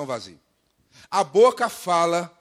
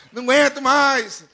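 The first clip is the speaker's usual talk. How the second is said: high, very loud fed-up cry; mock complaining; 7 semitones higher, 10 dB louder than usual. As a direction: wail it like a chronic complainer. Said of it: "tem gente que reclama".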